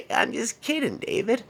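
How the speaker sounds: high-pitched coaxing woman; Sarah. Retold or imitated. Woman inviting David to the park, high put-on coaxing. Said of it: "She's like".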